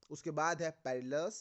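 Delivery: normal talking voice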